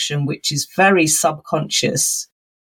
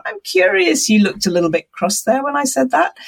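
higher, lightly inquiring and open; curious tone; 8 semitones higher than usual. Shown as a demonstration. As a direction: ask it with genuine interest, no blame. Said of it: "Is it a curious tone of voice".

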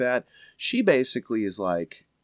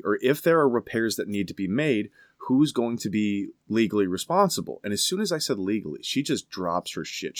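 usual speaking voice

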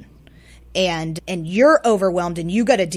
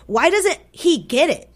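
high-pitched exasperated plea; exasperated, higher-voiced inner cry; retold frustration; 8 semitones higher, 3 dB louder than usual. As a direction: act out a parent's frustrated question, pitched up. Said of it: "I'm so frustrated".

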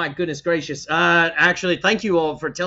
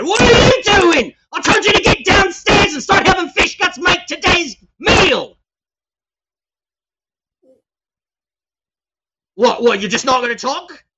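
furious screaming; 11 semitones higher, 9 dB louder than usual. Acted. Plug screams at Azara for not going below deck, furious. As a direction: bellow at the mute sailor.

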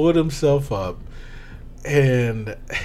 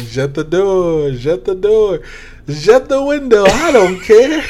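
hammed-up French accent, rising higher and louder; mock French accent; 6 semitones higher, 5 dB louder than usual. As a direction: fake French lover climbing higher and louder. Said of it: "he's like".

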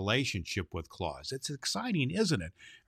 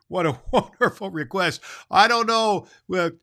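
laughing; 9 semitones higher, 8 dB louder than usual. Real, not acted. Laughing at the letter.

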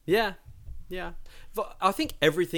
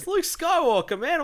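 indignant; 5 dB louder than usual. Real, not acted. Indignant complaint.

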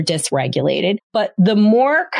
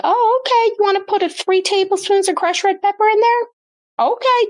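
much higher, sing-song fake-encouraging mom; a mom hiding her doubts with sugary okays; mock-sweet mom; 14 semitones higher than usual. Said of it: "you're like".

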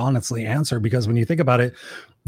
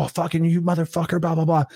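higher-pitched mock cursing; mock pissed-off; 4 semitones higher than usual. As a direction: act out a driver's rant, not in full earnest. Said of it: "I'm all pissed off".

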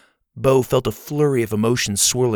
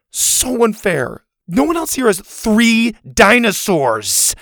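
slow, higher sulky protest; whiny complaint; 11 semitones higher, 5 dB louder, slower than usual. A narrator's reading. Zeta sulking to the class, slow whine. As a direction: drag it out like a sulking kid.

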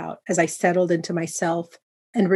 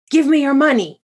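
loud, raised robber's demand; 8 semitones higher, 7 dB louder than usual. A narrator's reading. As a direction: order it high and forcefully. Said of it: "I demanded".

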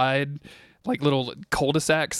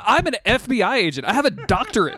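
much higher, louder indignant Scully voice; exasperated Scully; 9 semitones higher, 4 dB louder than usual. Retold or imitated. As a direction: play Scully snapping at Mulder, fed up. Voicing annoyed Scully at Mulder.

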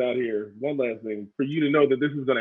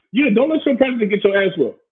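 loud emphatic; 9 semitones higher, 6 dB louder than usual. Real, not acted. Heated rant at protester.

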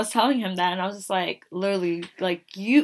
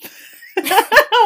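high laugh; 11 semitones higher, 8 dB louder than usual. Real, not acted. Laughing in disbelief.

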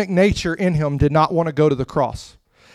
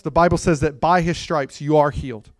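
ordinary talk

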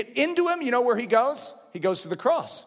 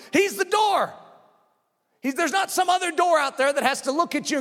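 high, insistent sermon voice; full-force preaching; 7 semitones higher than usual. Real, not acted. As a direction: press it on the congregation.

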